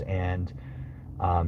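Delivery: normal talking voice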